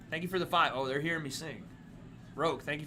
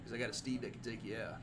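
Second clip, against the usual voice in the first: cowboy voice; 4 semitones lower, 10 dB quieter than usual. Pretend. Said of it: "He just turns into an impression of his dad".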